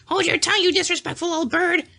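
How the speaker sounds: high squawking parrot copy; parrot mimicking. A narrator's reading. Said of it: "cried Polly".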